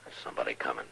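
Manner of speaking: quiet sneaking voice; hushed. Acted. Sneaking up on the house.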